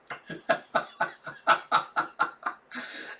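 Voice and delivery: breathy real laughing; amused laughter. Real, not acted. Reader amused by the First Lady line, breathy laughter. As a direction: crack up for a moment.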